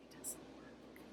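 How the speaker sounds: breathy whispered aside; whispering. Real, not acted. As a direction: whisper it to yourself. Quiet rueful aside.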